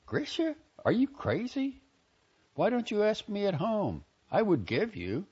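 high, startled plea to a brother; alarmed protest. Retold or imitated. To her brother holding a gun, startled high plea. Retold shock at gunpoint.

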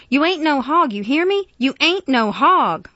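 loud, high, forceful insistence; fierce, pleading rebuke; desperate scolding. A narrator's reading. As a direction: drive it into him, stretching the last words. Miss Emma desperately scolds Jefferson.

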